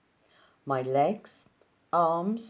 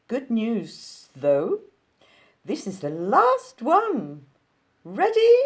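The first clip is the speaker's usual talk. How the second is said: high, loud lilting Capitol voice; sing-song Capitol accent climbing to a squeak on ready; 7 semitones higher, 9 dB louder, slower than usual. Acted. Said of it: "Why do these people speak in such a high pitch".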